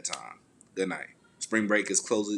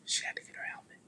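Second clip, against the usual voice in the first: whispering; 10 dB quieter than usual. Real, not acted. Child present, hushed.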